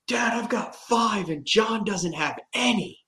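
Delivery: put-on high, slow, proud boyish voice; mock young boy bragging; excited kid. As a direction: brag to dad like a pumped teen. Kid bragging to dad on phone.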